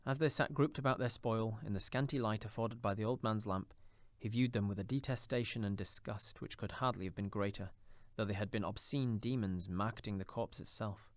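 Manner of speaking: ordinary delivery, nothing marked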